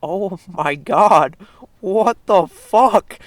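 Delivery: slow, loud, stunned oh my God rising high; stunned disbelief, drawn out and loud; shocked. Retold or imitated. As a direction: gasp it out in disbelief, laughing. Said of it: "you're just like".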